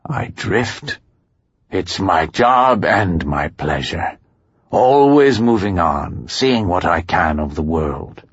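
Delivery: very slow, savoring cartoon bear; rough-voiced contented drifter. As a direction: relish the wandering life, drawing out every phrase. Voices Mister Blackwood.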